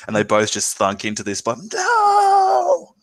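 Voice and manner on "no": long, very high acted "no"; high wailing shout of defiance; defiant scream. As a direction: cry out in drawn-out mock agony. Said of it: "I'm screaming in defiance".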